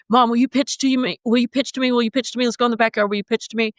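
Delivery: put-on high, eager kid voice begging over and over; child pleading. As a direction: plead like a small boy tugging at his mom. Son begging mom to pitch.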